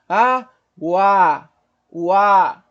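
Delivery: "Água" loud with a long final A; sustained A. Shown as a demonstration. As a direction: glide "O A" into one held, open A. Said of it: "Observa como se sustenta o A".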